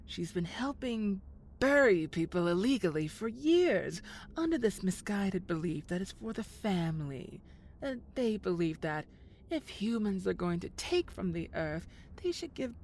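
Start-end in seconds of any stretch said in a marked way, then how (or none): none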